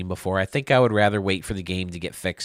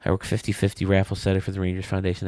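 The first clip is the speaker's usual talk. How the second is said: ordinary delivery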